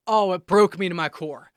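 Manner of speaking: ordinary talk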